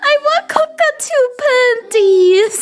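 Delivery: ordinary talk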